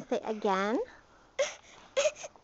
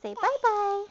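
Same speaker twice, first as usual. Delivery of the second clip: high sing-song coaxing; 10 semitones higher, 9 dB louder than usual. Real, not acted. Coaxing toddler to wave.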